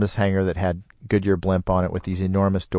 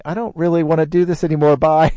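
mock scared kid; 8 semitones higher, 4 dB louder than usual. Scared nine-year-old's thought.

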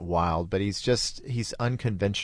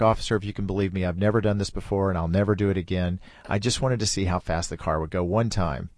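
rushed, fast nervous pleading; scared blurting; faster than usual. Retold or imitated. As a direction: blurt it out quickly like a caught kid. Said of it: "I just blurted out 'cause I was really scared".

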